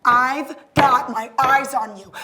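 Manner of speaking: loud, slow, drawn-out warning; forceful, deliberate menace; mounting threat. Acted. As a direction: lean hard on each word. Threat repeated, escalating.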